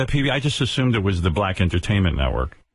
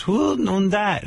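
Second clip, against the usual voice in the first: Heidi puzzled; 10 semitones higher, slower than usual. Retold or imitated. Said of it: "She goes".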